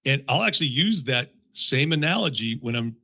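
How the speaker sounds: ordinary delivery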